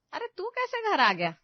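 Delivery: high-pitched astonished question; surprised mother. A narrator's reading. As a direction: react to an unexpected arrival, pitch jumping. Mother surprised son came home.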